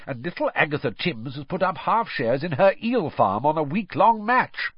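high, enthusiastic patter; eager. A narrator's reading. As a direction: rattle off your bets with relish. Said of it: "he said eagerly".

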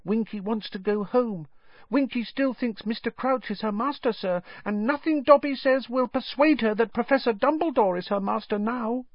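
sorrowful, high-pitched elf voice; small squeaky voice, troubled and confiding; Dobby. A narrator's reading. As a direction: explain it sadly as a tiny house elf. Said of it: "Dobby whispered sadly".

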